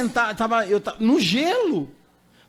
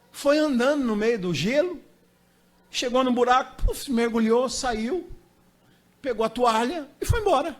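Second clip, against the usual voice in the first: normal talking voice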